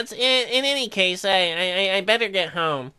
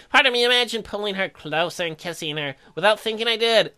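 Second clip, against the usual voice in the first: usual speaking voice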